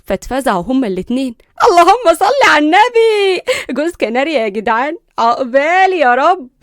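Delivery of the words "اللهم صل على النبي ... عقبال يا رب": a hammed-up ululating woman's blessing; Jood, cheering. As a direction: celebrate loudly like an excited auntie. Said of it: "زغرتت وقتها جود".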